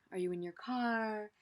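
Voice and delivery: ordinary talk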